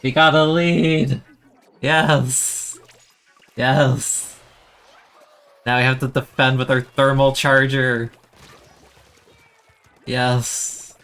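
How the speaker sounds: loud, high cheering; excited cheering. Real, not acted. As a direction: shout it like you just grabbed the lead. Team takes the lead.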